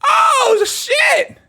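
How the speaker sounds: high shocked yelp. Real, not acted. Reactor seeing a contestant in a wheelchair, shocked high yelp. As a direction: squeal in disbelief.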